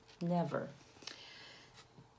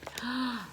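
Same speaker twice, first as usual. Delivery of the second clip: excited gasp; 7 semitones higher, 4 dB quieter than usual. Acted.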